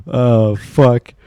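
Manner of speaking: usual speaking voice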